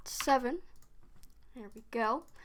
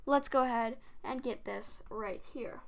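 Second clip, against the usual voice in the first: ordinary talk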